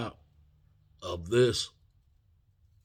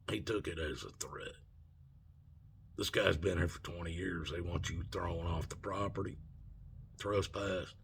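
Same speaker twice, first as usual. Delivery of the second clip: softer, subdued official's aside; retold confiding warning; 3 semitones lower, 8 dB quieter than usual. Security head warns student he may be trespassed, subdued. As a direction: bring the voice down to level with him.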